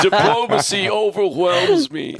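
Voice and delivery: very high, stately Northeast father voice; Northeast dialect. Retold or imitated. Diplomat father on his son's machine, mock Northeast dialect. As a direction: mimic a grand Northeast diplomat. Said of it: "like, Northeast, uh, dialect".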